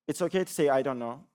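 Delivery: ordinary delivery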